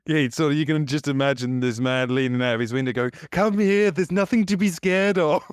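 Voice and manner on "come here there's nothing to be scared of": high, sly coaxing stranger voice; creepy voice. Retold or imitated. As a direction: lure them in like a creepy stranger. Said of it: "Why do you do it in a creepy voice?".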